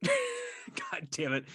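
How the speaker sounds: high, slow, drawn-out laughing curse; embarrassed at own face on camera, high drawn-out curse; exasperated groan. Real, not acted. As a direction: groan it out at yourself through a laugh. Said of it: "I'm only saying "God damn it" because I'm looking at myself".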